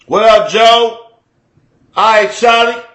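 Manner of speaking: lilting, loud Chicago greeting. Shown as a demonstration. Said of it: "That's how we talk".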